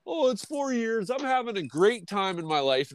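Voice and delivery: high, airy mock-carefree woman's voice; mocking Laurie Strode. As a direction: toss it off like a woman pretending she's moved on. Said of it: "And Laurie Strode's all like".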